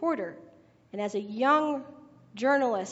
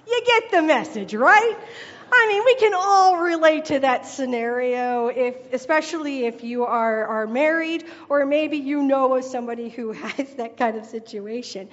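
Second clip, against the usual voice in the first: usual speaking voice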